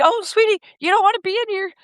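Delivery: high, cooing instructor talking to a bird; sweet teacher voice. Retold or imitated. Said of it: "She's like".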